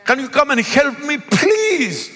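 high, pleading plea for help; pleading phone call. Pretend. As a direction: beg a friend to come over. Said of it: "pick up the phone and say".

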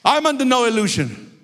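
high, earnest pulpit prayer; fervent preaching. Real, not acted. Preacher asking Jesus to pray for him, fervent.

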